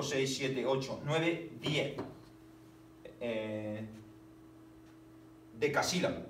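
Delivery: ordinary talk, nothing marked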